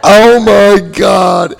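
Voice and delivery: loud, high, long-drawn words; amused exclamation. Real, not acted. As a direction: stretch it out loudly over the laughter. Reacts to his own funny story.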